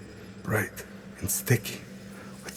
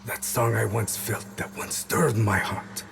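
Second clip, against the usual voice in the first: usual speaking voice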